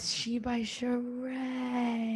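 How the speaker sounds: slow hushed whisper. Real, not acted. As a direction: breathe it out slowly.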